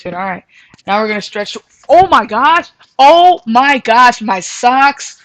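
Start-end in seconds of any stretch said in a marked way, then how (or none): 1.9-5.2 loud, high alarmed cry; startled yelp. Real, not acted. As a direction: yelp as feet slide. Slipping in socks mid-stretch.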